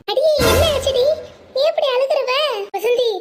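loud, high-pitched cry of alarm; startled and worried for a weeping friend; alarmed concern. Acted. (பதறிப்போய் உரத்த குரலில்)

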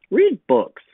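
brisk big-sister advice. A narrator's reading. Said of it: "she said".

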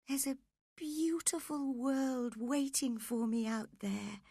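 high, dreamy longing; dying Catherine. Acted. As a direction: speak as a dying woman yearning to be free. Voices Catherine, longing to escape.

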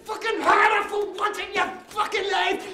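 high, loud, desperate yelling; furious shout. Acted. Son goading his father to strike him, shouting. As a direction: scream at him to hit harder.

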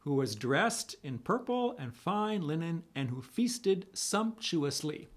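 normal talking voice

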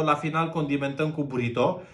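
normal talking voice

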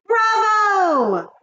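long, high, loud cheer; shrieked witchy applause; cheering witches. A narrator's reading. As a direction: whoop it like an adoring witch crowd. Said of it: "cried the witches".

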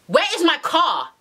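exasperated demand. Retold or imitated. Girlfriend demanding her car back, exasperated. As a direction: act out losing patience on the phone. Acted quarrel over borrowed car.

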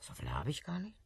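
whispered mock echo. Woman whispering his words back before a laugh.

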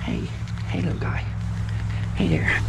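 whispered hello to a critter; hushed coaxing while sneaking up. Real, not acted. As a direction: whisper so he won't run. Sneaking up on animal.

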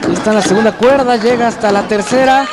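steady ringside play-by-play; wrestling commentator. Real, not acted. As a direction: call the match live from ringside. Live lucha libre commentary.

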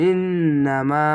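ইন্নামা slowly, the নন drawn through the nose; nasal ghunnah. Shown as a demonstration. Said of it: "এখানে ওয়াজিব গুণনা".

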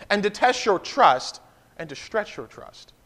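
ordinary delivery

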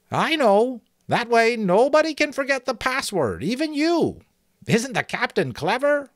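very high, loud piping voice; high-voiced sentry. Sentry admiring the captain's clever password, high piping. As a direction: gush in a thin high voice.

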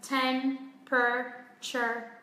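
Temperature slowly as tem-pruh-cher; very slow three-beat Temperature; three syllables. Shown as a demonstration. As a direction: sound out Temperature in three separated parts. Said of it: "chỉ có ba âm tiết thôi".

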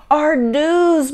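high, loud hammed-up lament; boo-hoo it for small listeners; mock boo-hoo. A narrator's reading. Mother laments their ruined hairdos, mock boo-hooing. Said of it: "boo-hooed Ava's mother".

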